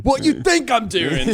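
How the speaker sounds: loud, high mock indignation; super sarcastic. Imitating a local challenged about the line, super sarcastic. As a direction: throw the question back with exaggerated sarcasm. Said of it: "super sarcastic".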